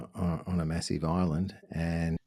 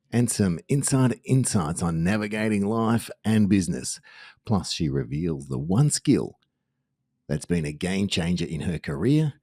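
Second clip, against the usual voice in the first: upbeat host sign-off read; punchy promo voice to close the show; podcast outro; 2 semitones higher, 3 dB louder than usual. Acted. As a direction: sell the next episode with energy. Host reads the episode outro.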